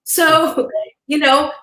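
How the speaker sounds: ordinary talk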